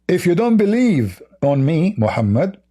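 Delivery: ordinary delivery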